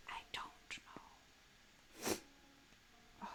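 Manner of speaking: whispered to herself while drawing. Real, not acted. Streamer unsure of her drawing, whispering to herself. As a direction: breathe it out, unsure.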